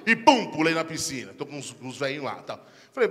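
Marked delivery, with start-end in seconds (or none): none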